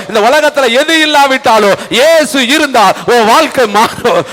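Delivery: ordinary delivery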